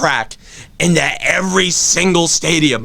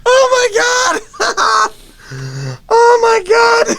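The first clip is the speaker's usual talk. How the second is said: high, slow, crying-laughing shout; anguished laughing wail; 3 dB louder, slower than usual. Real, not acted. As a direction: howl in mock agony. Comic despair outburst.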